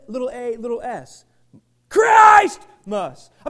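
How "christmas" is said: shouted word. Real, not acted. Pastor crying out to the church, full-force shout. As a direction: shout it, high and much louder, drawn out.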